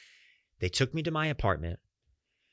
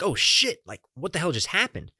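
sudden shocked outburst, higher and louder; alarmed; 8 semitones higher, 3 dB louder than usual. Retold or imitated. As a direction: caught off guard by a sharp stab of pain. Sudden back pain, retold reaction.

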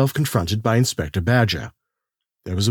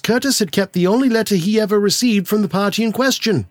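higher, lighter gentleman's voice; Marchmont's voice; 9 semitones higher than usual. A narrator's reading. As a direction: lift into a polite solicitor.

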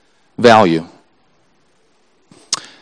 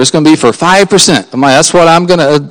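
normal talking voice

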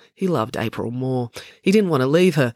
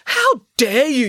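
high, loud, drawn-out yell; outraged yell; 11 semitones higher, 6 dB louder, slower than usual. Acted. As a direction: yell it slowly, full of outrage. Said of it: "yelled Maya".

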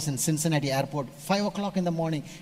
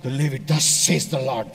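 hammed-up solemn "thus says the Lord"; lightly puffed-up holy tone; a hint of a pompous prophet; mock prophetic voice; 4 semitones lower than usual. Shown as a demonstration. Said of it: "You speak normal".

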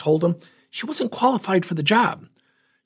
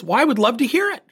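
high-pitched retold indignation; angry mother; 9 semitones higher, faster than usual. Mother challenging the coach to explain, mildly angry imitation. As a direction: mimic an angry parent, voice pitched up. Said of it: "She's angry".

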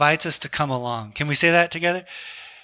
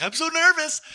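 very high, worked-up mimicry; imitated excited nerves; 11 semitones higher than usual. Hunting friend nervous before the shot, imitated excitement. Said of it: "he was way more excited".

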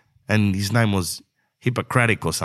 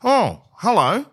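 mock doctor greeting; 10 semitones higher, slower than usual. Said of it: "The specialists have said the same thing".

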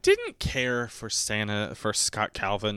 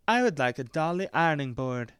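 put-on lightly higher, cutesy kid voice; imitating a child; 5 semitones higher than usual. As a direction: ask sweetly like a small child. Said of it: "they all sounded like this when I imitate children".

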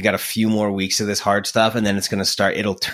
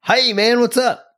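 much higher, bright and eager hey man; cheery phone greeting; 13 semitones higher, 4 dB louder than usual. Shown as a demonstration. Host showing his friend how a happy phone answer sounds. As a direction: answer like you're smiling. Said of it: "you gotta answer it like Jim Miller".

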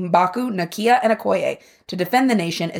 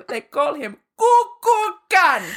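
slow, higher hammed-up accent; mock Namor accent; 7 semitones higher, slower than usual. Cleaning her workspace, quoting Namor in a mock accent. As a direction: hum it to yourself in a mock accent. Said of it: "I'll be, like, cleaning".

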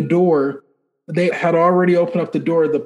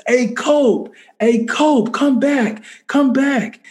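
calling out; 8 semitones higher, slower than usual. Retold or imitated. Inmate calls him back.

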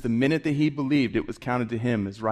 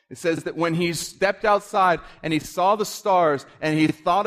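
high, loud, fervent preaching; impassioned preacher voice; full-force preaching; 7 semitones higher, 4 dB louder than usual. Acted. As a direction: preach it out to the congregation, building.

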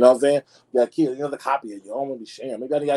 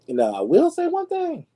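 much higher, slow emphatic announcement; excited build-up; 4 dB louder than usual. Real, not acted. Vlogger builds up her praise of Ben Affleck, high and slow. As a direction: lift way up and savor it.

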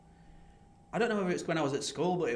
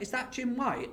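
high awed question; a touch of star-struck awe; high excited recognition; starstruck; 9 semitones higher, 3 dB quieter, slower than usual. Retold or imitated. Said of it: "and I was like".

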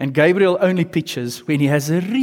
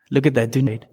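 ordinary talk

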